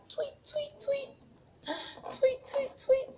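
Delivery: very high, thin birdy tweeting; chirpy bird tweets. Shown as a demonstration. Teacher showing parents the bird sound, high chirpy tweets. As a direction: chirp it out like a little bird for the kids. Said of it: "What does the bird say?".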